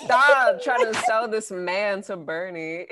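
loud, slow, laughing protest. Real, not acted. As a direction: call them out loudly, slowly. Friends hyping a dating profile.

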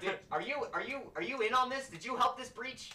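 high, loud, stammered interrogation; suspicious wizard. Acted. Wizard interrogates player about helping the breach, agitated.

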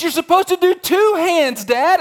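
high, loud mock whine of a child; protesting daughter. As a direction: imitate an indignant kid objecting to Dad. Daughter protesting in retold story.

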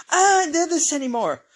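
hammed-up fed-up cry; exasperated wail. People fed up with war, wailing in mock despair. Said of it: "people go".

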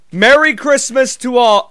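high, loud holiday call; big theatrical greeting in your own accent; hearty Santa proclamation. Acted. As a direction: proclaim it to the whole crowd, no accent. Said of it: "And we say".

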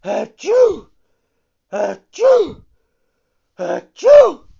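explosive high "achoo"; acted sneezes. A narrator's reading. Fire Eater pitying the old man, explosive sneezes. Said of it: "he sneezed three times".

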